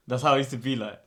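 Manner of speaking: usual speaking voice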